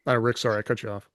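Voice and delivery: ordinary talk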